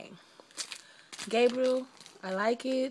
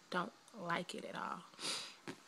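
soft unvoiced whisper, slow; 2 semitones lower, 11 dB quieter, slower than usual. Real, not acted. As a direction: breathe it out, barely voiced. Quiet aside about the cesarean.

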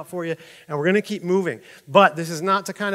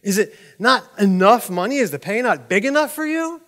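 acted indignant challenge, pitched up; insulted; 6 semitones higher, 5 dB louder than usual. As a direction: demand an answer as if personally offended. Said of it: "He's insulted".

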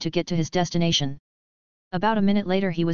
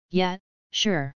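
deadpan. A narrator's reading. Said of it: "Luffy deadpanned".

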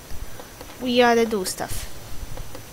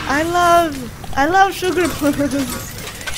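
higher, laughing, slow on love; gushing, laughing; 5 semitones higher, slower than usual. Real, not acted. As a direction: gush about it through laughter.

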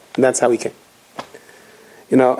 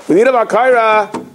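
gabbai insisting; 7 semitones higher than usual. Retold or imitated.

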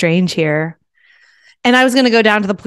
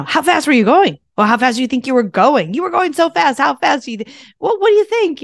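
higher, pressing mock cop interrogation; mock pushy traffic cop; 6 semitones higher than usual. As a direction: badger him like a pushy cop, half-mocking. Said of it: "who was yelling at me".

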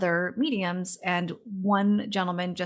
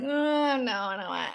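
mock whine; 5 semitones higher, much slower than usual. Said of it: "I whine like".

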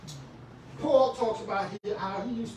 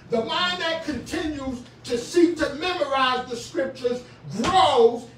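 higher, louder, stretched-out preaching; preaching at full force; 7 semitones higher, 7 dB louder, slower than usual. Acted. Preacher urging church to memorize scripture, full-force preaching. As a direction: drive the point home to the congregation.

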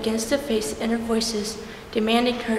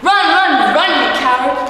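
loud, higher screamed orders; screaming voices; 4 semitones higher, 10 dB louder than usual. A narrator's reading. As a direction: chase her out the door with a yell. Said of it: "The voices were screaming at me".

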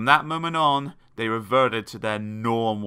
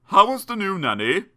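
lightly voiced dad, raised pitch; a higher, louder dad asking eagerly; father's question; 8 semitones higher, 6 dB louder than usual. A narrator's reading. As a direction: call out the question a little higher. Father voiced asking after nanny.

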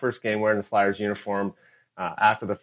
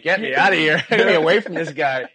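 laughing plea; 7 semitones higher, 7 dB louder than usual. Retold or imitated. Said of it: "I'm like".

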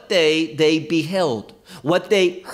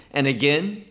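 ordinary delivery

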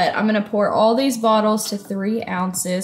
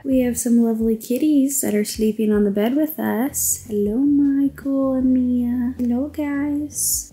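cooing at cats; 4 semitones higher than usual. Real, not acted. Greeting the cats.

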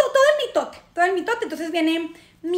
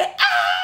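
sudden hammed-up wail; mock wailing. Imitating girls crying in a drama, mock wail. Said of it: "Llorando en un drama".

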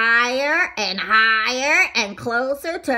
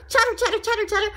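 chattering teeth; 10 semitones higher, much faster than usual. Acted. Teeth chattering sound effect.

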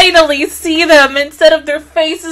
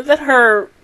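usual speaking voice

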